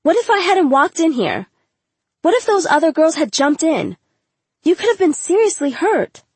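high-pitched, worried reprimand; exasperated, high-voiced lecture; alarmed scolding. A narrator's reading. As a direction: press home how bad it could have been. Teacher scolding student after fight.

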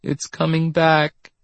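high, slow, frightened voice; small scared voice, drawn out; trembling. A narrator's reading. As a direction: say it slowly, high with dread. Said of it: "she trembled".

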